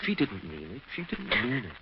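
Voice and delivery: low, soft, slow comfort; calm her down gently; husband comforts his hurt wife softly; soothing. Acted.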